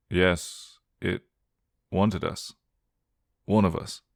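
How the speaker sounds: plain reading voice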